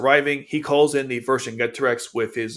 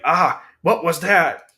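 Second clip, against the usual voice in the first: mock-startled cry, louder and drawn out; 5 semitones higher, 6 dB louder, much slower than usual. Recapping a character caught off guard by the Geass, startled. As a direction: react with a loud, slowed startled outburst.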